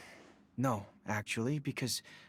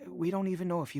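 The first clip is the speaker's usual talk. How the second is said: higher, pleading and close to tears; distraught mother; 6 semitones higher, faster than usual. Acted. Mother begging for news of her son, higher and distraught. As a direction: cry out for her lost boy.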